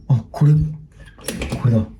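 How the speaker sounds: usual speaking voice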